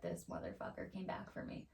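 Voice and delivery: hushed, paranoid whisper; scared of the bird at her door, whispered. Retold or imitated. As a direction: breathe it like it's watching you. Fearful retold thought about bird.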